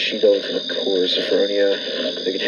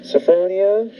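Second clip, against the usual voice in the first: calling out; 10 semitones higher, slower than usual. Acted. Husband calls through locked door.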